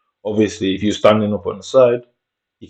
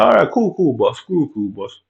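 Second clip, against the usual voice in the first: mock backing-off; 5 semitones higher than usual. Man in car backing off.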